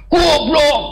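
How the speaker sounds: high-pitched outburst of an angry person; mock angry yell. Angry person yelling at someone standing close, mock angry yell. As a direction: yell the insult as if losing your head. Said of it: "orang marah deket tapi teriak".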